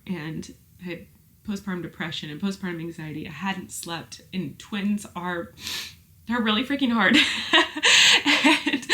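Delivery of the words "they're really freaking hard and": loud, high, bursting into laughter; laughing admission. Real, not acted. Exhausted mother of twins admitting the struggle, laughing. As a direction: let it burst out in a big laugh.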